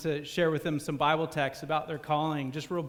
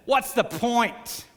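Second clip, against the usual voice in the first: shouted; 5 semitones higher, 4 dB louder, much slower than usual. Retold or imitated. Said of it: "he shouted".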